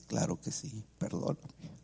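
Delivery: hushed, choked-up imitation of consent; mock tearful. Mother giving in to son, mock tearful. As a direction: drop to almost nothing, as if crying. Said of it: "ella empieza a llorar y dice".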